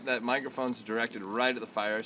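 usual speaking voice

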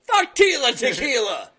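shouting. Pretend. Mock rally cry at concert.

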